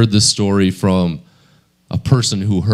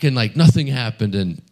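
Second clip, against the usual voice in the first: ordinary talk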